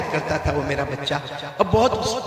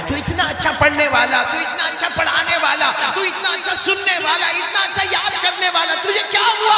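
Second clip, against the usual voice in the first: high, building, insistent questioning; astonished outcry; 13 semitones higher, 5 dB louder than usual. Retold or imitated. Teacher to his burning student in a dream, astonished. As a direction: climb higher with each phrase to a cry.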